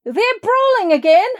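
very high, loud shrill alarm; shrill shriek. Acted. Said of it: "the innkeeper shrieked".

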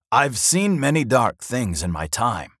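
slow, loud dwarf voice; grave dwarf warning. A narrator's reading. Dwarf warns his magic-using friend, slow and grave.

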